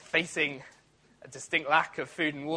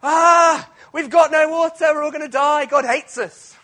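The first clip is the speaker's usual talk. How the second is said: shrill mock wail, much higher and louder; whiny panicked shout; 11 semitones higher, 11 dB louder than usual. Preacher mocks the Israelites' grumbling with a panicked wail. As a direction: ham up a melodramatic moan of doom. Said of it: "they go".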